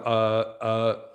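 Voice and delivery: mock drawn-out stalling; imitating hesitant speaker. Teacher mimicking a footballer with nothing to say, mock hesitation. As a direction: hold each filler as if stuck. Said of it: "he has nothing to say".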